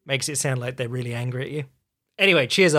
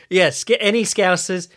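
normal talking voice

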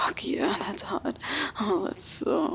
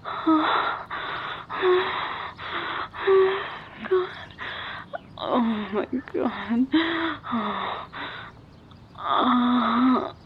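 aroused moaning; 3 semitones higher, 4 dB louder, much slower than usual. Acted. Performing for a listening lover, loud aroused moans. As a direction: moan out loud on a breath. Mounting arousal in intimate roleplay.

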